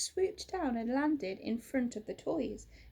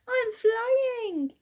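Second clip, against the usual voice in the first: much higher and louder, thrilled; joyful shout; 12 semitones higher, 10 dB louder than usual. Acted. Little Bear riding the owl, shouting with joy. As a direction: shout with pure delight while flying. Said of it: "cried Little Bear".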